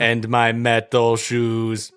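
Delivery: low, slow robotic villain; robot count voice. Pretend. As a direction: drawl it out like a cyborg count. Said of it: "Cyborg Count Trivia".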